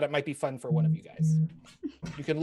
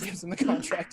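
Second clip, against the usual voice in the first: ordinary delivery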